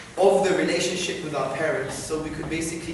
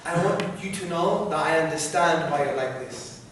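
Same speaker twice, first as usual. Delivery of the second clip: softer, tender appeal to a parent; empathizing; 3 semitones lower, 5 dB quieter than usual. Pretend. Son winning over his angry parents, empathizing. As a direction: reassure a parent gently, in a hushed sincere voice. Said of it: "by empathizing with them and say".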